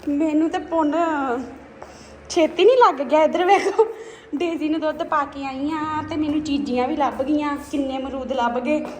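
delighted laughing. Real, not acted. Showing viewers the guavas she found, excited and laughing. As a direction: giggle it out, thrilled by the find.

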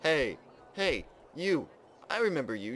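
high, loud calls in short bursts; accusing call. Pretend. Player confronting a game character who shot them, loud accusing call.

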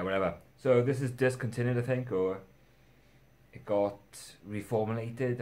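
ordinary delivery

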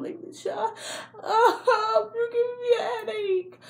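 very high, drawn-out mock wail; exasperated whine. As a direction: whine it out as a hammed-up sob of exasperation. Exasperated outburst at his questions.

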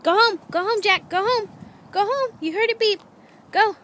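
shouted high commands to the dog; loud command. Real, not acted. Sending dog home, calling out.

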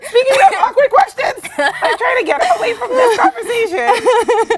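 high, slow, laughing through the words; giggling to dodge the topic; laughing escape. Real, not acted.

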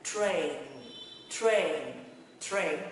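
TRAIN with TR blend and tongue lifted at the end. Shown as a demonstration. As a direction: model "train" slowly as a single falling word. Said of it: "và đánh cái lưỡi lên".

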